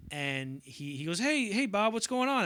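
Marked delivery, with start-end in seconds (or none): 1.2-2.4 higher, louder eager hello; excited greeting. Retold or imitated. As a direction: greet him eagerly, voice up and loud. Ray greets De Niro.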